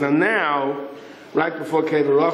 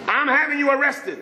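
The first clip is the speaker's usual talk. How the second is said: high, emphatic arrest threat; stern threat; 10 semitones higher than usual. Retold or imitated.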